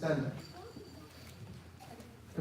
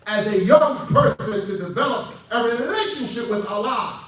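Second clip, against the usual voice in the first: forceful, raised preaching; emphatic preacher's proclamation; 6 semitones higher, 5 dB louder than usual. Real, not acted.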